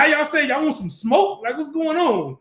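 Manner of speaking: high-pitched mock confrontation; street-slang challenge. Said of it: "So how we would say it is".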